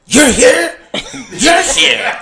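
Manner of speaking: high, loud, strained mock surprise; rival joke thieves. Two joke thieves spotting each other, high startled voices. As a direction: squeal it at your rival. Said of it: "they look at each other like".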